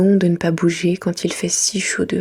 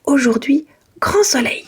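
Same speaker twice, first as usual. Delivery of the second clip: loud whispered call, pitched up; shouting; 7 semitones higher, 3 dB louder than usual. Acted. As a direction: call out the window. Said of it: "Je crie en ouvrant la fenêtre".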